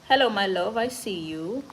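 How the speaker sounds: normal talking voice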